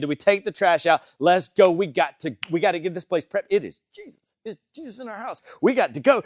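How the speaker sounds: loud, high-strung rush through a checklist; rally the household in an acted fluster; mock-panicked host before a big guest; frantic hostess. Acts Martha rushing before Jesus arrives.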